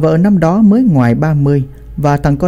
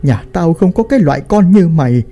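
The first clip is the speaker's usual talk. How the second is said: put-on old father disowning his son, pitch soaring by the end; angry denunciation; 5 semitones higher than usual. A narrator's reading. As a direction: narrator acting a wrathful banishment, building to a high cry. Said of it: "chỉ tay vào thằng con trai mình mà nói".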